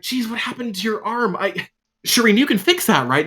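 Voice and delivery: very high, anxious voice; Zolt's worried question. Acted. Zolt asking Shireen to fix Morgaine's arm, very high and worried. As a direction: fret over the arm in a squeaky voice.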